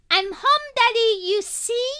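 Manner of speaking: put-on high, loud toddler chatter; a small girl twittering at her dad; twittering little girl. A narrator's reading. Daughter in her father's arms, twittering happily. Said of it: "she's still twittering".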